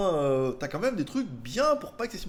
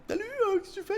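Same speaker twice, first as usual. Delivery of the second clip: voix de fille imitée, bien plus aiguë; imitation de sa copine. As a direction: imite sa copine qui l'appelle le soir, voix très aiguë. Il imite sa copine au téléphone. (sa copine qui l'appelle le soir, voix imitée très aiguë)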